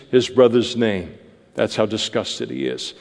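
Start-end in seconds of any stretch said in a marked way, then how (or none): none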